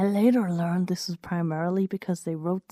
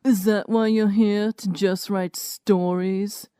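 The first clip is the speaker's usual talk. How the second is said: lightly voiced Juan; 2 semitones higher, 4 dB louder, slower than usual. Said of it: "offered Juan".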